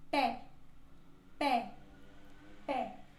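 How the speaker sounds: pé with a wide open é; open e demo. Shown as a demonstration. Teacher models the open é in pé. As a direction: open the mouth on pé. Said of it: "ela se pronuncia mais aberta".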